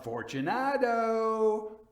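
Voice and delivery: high, slow, drawn-out call into the dark; calling aloud. A narrator's reading. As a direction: call the name out long and high. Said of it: "I called aloud".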